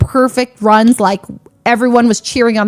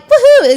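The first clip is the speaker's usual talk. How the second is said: high, loud little cheer; gleeful woohoo; 7 dB louder than usual. Retold or imitated. Spinning in circles after winning, gleeful cheer. Imitating celebration while spinning.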